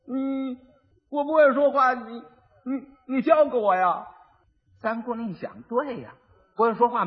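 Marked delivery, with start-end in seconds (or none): 0.1-4.1 higher-pitched, dim and halting; simpleton husband. Acted. Simpleton asking his wife to teach him to talk, halting and dim. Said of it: "傻子就过去了".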